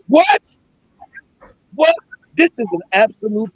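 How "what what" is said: high rising what of disbelief; can't believe what he heard; incredulous what. Real, not acted. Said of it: "reacting in disbelief".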